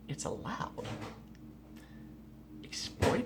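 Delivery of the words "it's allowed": soft, secretive aside; conspiratorial whisper. Pretend.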